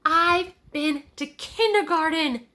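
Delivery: put-on high, slow little boy voice; a proud small child announcing; childlike proud announcement; Tommy announcing. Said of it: "he announced".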